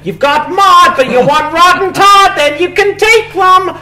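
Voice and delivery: sing-song churchy neighbour hailing Satan, squeaky high; Ned Flanders voice. Acted. Improviser as cheery neighbour hailing Satan, high sing-song cartoon voice.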